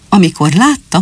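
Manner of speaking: plain reading voice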